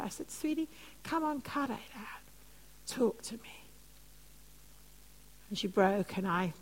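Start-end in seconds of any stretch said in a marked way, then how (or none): 0.3-3.6 much softer, gentle and firm; quietly coaxing the girl to open up. Retold or imitated. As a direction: hush her anger with kindness.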